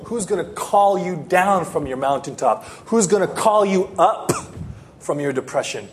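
ordinary talk

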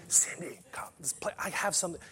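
soft whispered invitation. Acted. Jesus whispering to Cindy, inviting. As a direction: coax her gently in a whisper.

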